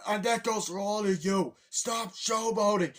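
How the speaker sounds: high, loud announcer-like telling-off; hammed-up shouted reprimand; imitated scolding. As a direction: order the whole crowd to quit it. Cranky Kong scolds the crowd.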